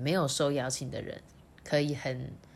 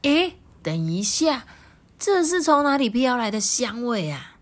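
curious frog; 6 semitones higher, 4 dB louder than usual. Acted. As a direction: wonder where the smell comes from. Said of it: "听完第一只青蛙的话".

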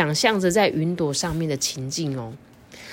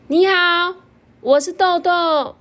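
high, slow little caterpillar voice; squeaky friendly bug greeting; high cartoon caterpillar; 13 semitones higher, 6 dB louder, slower than usual.

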